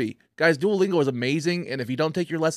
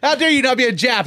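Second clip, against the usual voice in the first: mock outrage; 10 semitones higher, 9 dB louder than usual. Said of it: "is what you just said".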